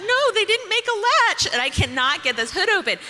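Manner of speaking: high, loud exasperated no; high loud exasperated protest to husband. Retold or imitated. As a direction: insist against your husband, fed up. Arguing with husband about latch.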